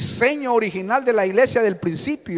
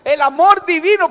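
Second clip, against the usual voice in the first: high and loud, "que has dejado" slowed and booming; loud verse reading; 8 semitones higher, 12 dB louder than usual. Shown as a demonstration. Preacher proclaiming the Lord's rebuke to the church, loud.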